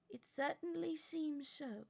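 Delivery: calm, unhurried assurance; old wizard voice. Acted. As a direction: Dumbledore reassuring, slow. Dumbledore voice.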